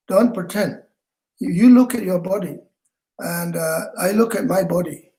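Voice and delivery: normal talking voice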